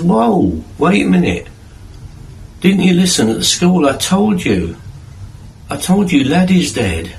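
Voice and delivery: put-on wife stopping the kids in the kitchen, flustered and exasperated. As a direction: stop them short, half scolding.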